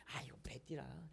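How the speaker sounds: slow secretive whisper. Acted. God confiding belt instructions, a slow whisper. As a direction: lean in and whisper it slowly.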